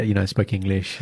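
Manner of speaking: normal talking voice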